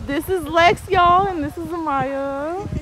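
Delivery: excited intro. Real, not acted. Introducing friends to camera.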